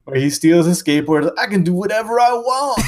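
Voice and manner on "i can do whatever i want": high-pitched mock tantrum voice; defiant brat. Boy stealing a skateboard, mock-defiant outburst. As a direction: whine it like a defiant kid. Acting out the bratty skateboard thief.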